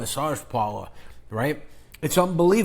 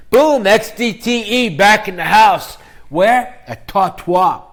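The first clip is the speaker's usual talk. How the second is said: loud, higher, slowed hype call; hype announcer; 5 semitones higher, 10 dB louder, slower than usual. Acted. Announcing his return to viewers, loud hype. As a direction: announce yourself like a show host.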